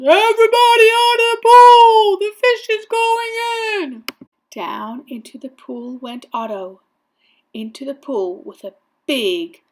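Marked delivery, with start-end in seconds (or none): 0.0-4.0 high, loud, sustained shout; yelled warning. A narrator's reading. Fireman warning swimmers out of pool, loud yell. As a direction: clear everyone away with a big yell. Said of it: "The fireman yelled".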